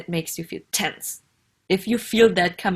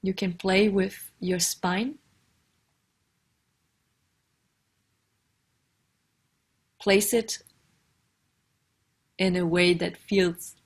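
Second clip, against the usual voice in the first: guided meditation. Acted. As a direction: ease the listener into a comfortable posture, unhurried.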